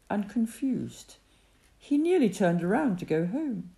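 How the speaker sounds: plain reading voice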